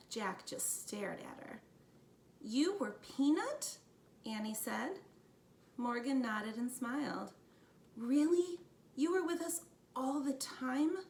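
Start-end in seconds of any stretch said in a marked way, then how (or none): 2.4-3.8 high, slow disbelieving question; a hint of a young girl's amazement; astonished. A narrator's reading. Annie realising the mouse was Morgan, astonished. 8.0-11.0 higher, disbelieving questions; a touch of a boy's wonder; amazed. A narrator's reading. Jack learning Morgan was with them, amazed.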